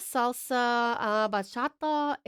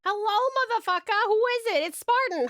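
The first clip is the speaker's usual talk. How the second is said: higher, louder sing-song hello; high sing-song greeting; 10 semitones higher, 4 dB louder than usual. Acted. Streamer repeats greeting playfully.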